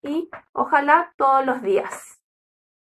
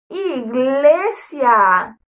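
iglesia stretched syllable by syllable, slow; slow drawn-out; much slower than usual. Shown as a demonstration. Teacher modelling iglesia slowly for children. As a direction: draw out iglesia slowly for the kids. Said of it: "Vamos todos juntos".

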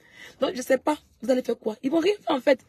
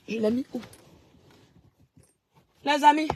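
ordinary talk

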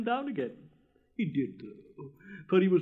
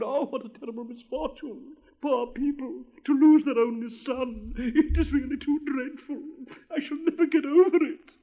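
high, hammed-up distress rising louder; melodramatic lament; 9 semitones higher, 5 dB louder than usual. Rocket mourns the prince's imagined only son, melodramatic lament. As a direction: wail it theatrically, building to a loud finish.

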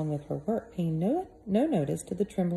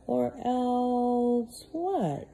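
gentle question; 7 semitones higher, much slower than usual. Acted. Peter asking Missus Molasses, slow gentle question. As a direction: coax the answer out gently. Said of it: "he asked gently".

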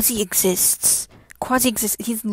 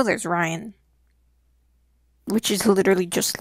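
ordinary talk, nothing marked

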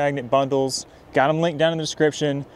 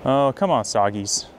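usual speaking voice

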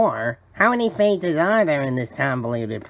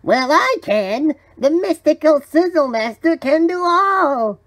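grand mystical proclamation; 11 semitones higher, 4 dB louder than usual. Acted. Magical spatula revealing its powers, grandly proclaiming. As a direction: proclaim it like an all-powerful talking object.

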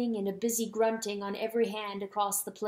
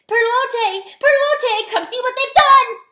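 very high, loud wail; crying out in grief; 14 dB louder than usual. A narrator's reading. Weasel holding dead widow mouse, crying out. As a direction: wail desperately for someone to come. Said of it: "he stood there crying".

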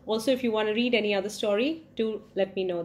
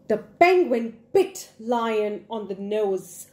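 ordinary delivery, nothing marked

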